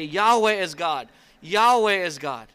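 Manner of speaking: loud, slow, awed declaration; loud proclamation. Acted. The people fallen on their faces crying out, slow and loud. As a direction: proclaim it slowly and loudly in awe.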